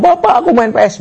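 put-on high-pitched eager child voice; child asking Dad. Child greets returning father to ask for games, high voice. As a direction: call up to Dad like a pleading kid. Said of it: "bapaknya pulang".

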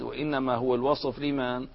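ordinary talk, nothing marked

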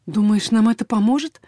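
plain reading voice

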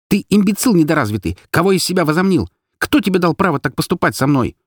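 high-pitched furious tirade; indignant, ranting outburst; raging. A narrator's reading. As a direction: hurl the insults, pitched high. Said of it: "рвала и метала я".

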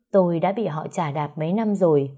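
plain reading voice

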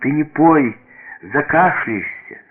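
anxious plea, pitched high; lightly voiced child's alarm; frightened girl. A narrator's reading. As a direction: beg grandfather to stop, worried. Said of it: "испуганно сказала девочка".

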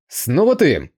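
stern rebuke to the nephew. A narrator's reading. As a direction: scold the caught thief. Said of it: "сказал строгим голосом Хан Сяо".

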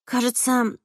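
a hint of Sandra piping up; Sandra's line. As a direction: voice Sandra lightly and quietly. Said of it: "подала голос Сандра".

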